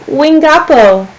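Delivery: put-on slow English-accented Wingapo. A narrator's reading. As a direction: Wingapo said carefully by an English mouth. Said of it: "spoken with an English accent".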